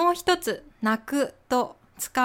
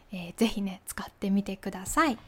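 soft unvoiced whisper; hushed aside to the learner; 4 semitones lower, 5 dB quieter, faster than usual. Real, not acted. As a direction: lean in and whisper it. Teacher whispers a closing tip.

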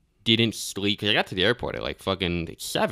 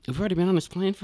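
fast exasperated retelling; 10 semitones higher, faster than usual. Retold or imitated. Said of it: "And I'm like".

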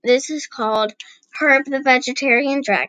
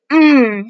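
a louder, savouring mmm over the soup; pleased mmm; 2 semitones higher, 7 dB louder than usual. A narrator's reading. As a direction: relish the taste out loud. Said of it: "he smiled, licking his lips".

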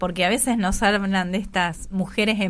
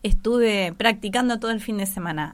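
normal talking voice

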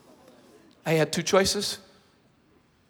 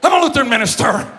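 louder, higher, half-laughing pronouncement; mock solemn declaration; 6 semitones higher, 11 dB louder, faster than usual. Pastor holding up his ring at the officer, mock solemn declaration. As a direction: declare it like warding off a vampire. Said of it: "holding it up like he's a vampire".

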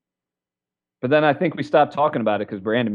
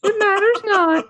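high put-on voice; 3 dB louder than usual.

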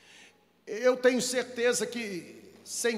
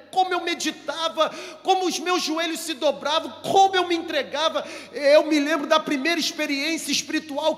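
high, loud, impassioned testimony; fervent preaching; 5 semitones higher, 4 dB louder than usual. Acted. Preacher voices a believer longing for early faith, fervently. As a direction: pour out the memories with mounting fervour. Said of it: "você diria assim".

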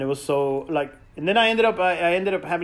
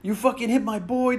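higher, hammed-up furious friend; mock angry yell; 5 semitones higher than usual. Manny confronting the drunk driver, mock angry yell. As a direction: bark it like a protective buddy. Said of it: "Manny loses his mind".

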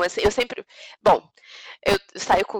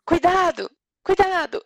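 calling out warnings; 5 semitones higher, slower than usual. Retold or imitated. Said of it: "Aí você não fica gritando".

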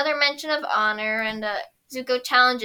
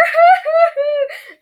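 high loud laugh; 11 dB louder than usual. Real, not acted. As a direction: crack up laughing. Laugh after cry.

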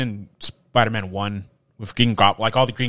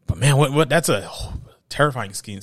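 ordinary talk

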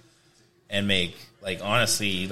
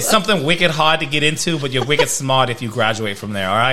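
louder hammed-up Boston bragging; Boston accent; 3 semitones higher, 6 dB louder than usual. Joking with friends about MIT, in a hammed-up Boston accent. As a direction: brag like a Boston guy who thinks he's wicked smart.

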